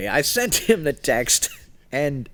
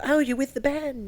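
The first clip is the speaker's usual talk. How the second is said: put-on Atlanta accent; 13 semitones higher than usual. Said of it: "in their best Atlanta accent".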